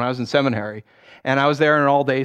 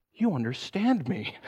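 touched wife; 3 semitones higher, 7 dB quieter, slower than usual. Retold or imitated. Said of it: "she goes".

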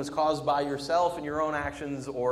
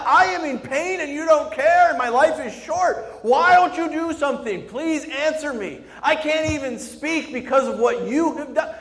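much higher and louder, crying out; angry at God; 14 semitones higher, 13 dB louder than usual. Retold or imitated. Preacher voicing David screaming angrily at God. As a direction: scream your pain at heaven. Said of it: "He is angry at God".

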